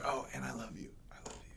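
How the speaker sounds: very soft, fading to a whisper; murmured endearment. Retold or imitated. Injured man to scolding partner, re-enacted faint whisper. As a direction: tail off into a tiny whispered afterthought. Patient placating partner, acted.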